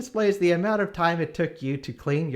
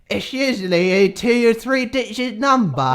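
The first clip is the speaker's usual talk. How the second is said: put-on Australian accent; 5 semitones higher, 7 dB louder than usual. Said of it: "That's what you think Australia sounds like?".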